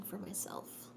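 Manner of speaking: soft whispered aside; whispering. Real, not acted. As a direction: let it fade to a whisper. Trails into whisper.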